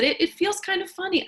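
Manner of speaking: put-on high, quick child voice; lightly voiced little girl. A narrator's reading. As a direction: voice a young girl unsure on the bike.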